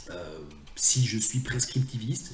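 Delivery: usual speaking voice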